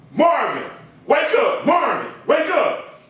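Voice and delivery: calling him awake. Retold or imitated. Barbers shout at the dozing Marvin, mock yell.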